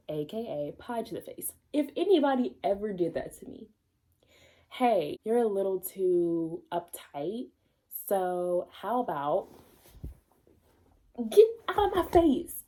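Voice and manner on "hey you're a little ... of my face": acting out telling Tony off, ending in a shout; mock angry retort. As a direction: drawl the setup, then yell get out. Reader acts her angry reaction.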